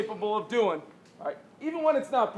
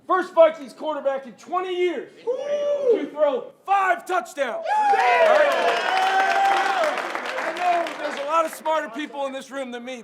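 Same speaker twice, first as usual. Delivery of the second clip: rally the team; halftime pep speech; 4 semitones higher than usual. Real, not acted. Coach hyping quarterback.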